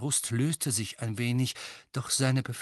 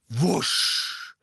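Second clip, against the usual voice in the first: plain reading voice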